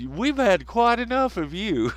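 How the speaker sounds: high-pitched put-on official scolding; mock indignant company. YouTube to troublesome user, mock indignant. Acting YouTube banning him.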